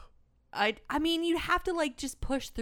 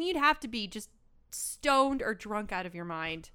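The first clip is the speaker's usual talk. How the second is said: ordinary talk, nothing marked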